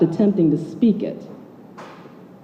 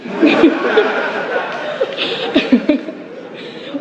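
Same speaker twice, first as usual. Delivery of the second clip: airy laugh; 8 semitones higher, 3 dB quieter than usual. Real, not acted. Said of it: "calling the crazy drivers".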